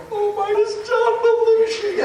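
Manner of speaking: high, slow, sung fangirl squeal; excited squeal. Retold or imitated. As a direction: swoon over the star in a singsong. Fans greet Belushi in awe.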